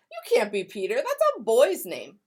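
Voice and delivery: put-on a boy scolding Grace; bossy classmate. Raj telling Grace she can't be Peter, bossy kid voice.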